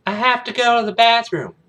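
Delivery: put-on high-pitched little-boy plea; Tiger. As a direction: plead like a six-year-old. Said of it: "the youngest one, Tiger".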